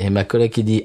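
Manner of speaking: usual speaking voice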